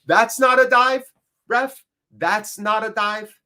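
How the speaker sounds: high-pitched appeal to the ref; real outraged complaint at a call; indignant protest. Real, not acted. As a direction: start loud and high, then stretch it out in disbelief. Protesting to the referee.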